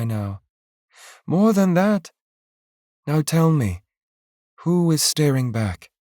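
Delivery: steady him, hold his gaze; wife calming her frightened husband; lightly voiced reassuring wife.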